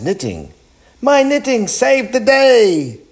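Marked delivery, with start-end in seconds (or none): 1.0-3.0 very high, loud delight; storybook lady proudly exclaiming; triumphant exclamation. A narrator's reading. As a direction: crow about it at the top of your range.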